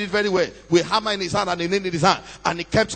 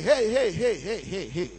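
"hey" repeated and dying away softer; fading echo; 5 dB quieter than usual. A narrator's reading. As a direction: let it fade like an echo among graves. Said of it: "The sound he made re-echoed".

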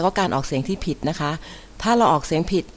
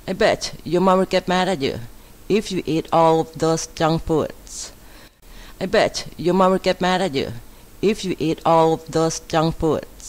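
model sentence reading; 3 semitones higher, much slower than usual. Shown as a demonstration. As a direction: read it out clearly for learners to repeat. Models English example sentences.

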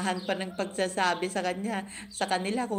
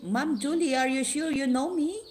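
teasing challenge; 11 semitones higher, 4 dB louder than usual. Retold or imitated. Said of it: "Sabi ni Sir Wonderful Camping".

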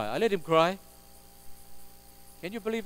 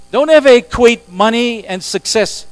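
loud warning; 7 semitones higher, 13 dB louder than usual. Real, not acted. Pastor warning the congregation.

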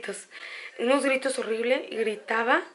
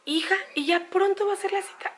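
higher, pleading voice of an anxious mother; a hint of a fretting elderly mother, higher; worried mother; 7 semitones higher than usual. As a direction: lightly play the mom asking again and again. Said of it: "mi mamá me decía".

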